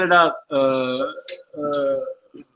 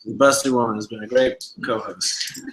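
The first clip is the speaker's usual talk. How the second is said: ordinary talk, nothing marked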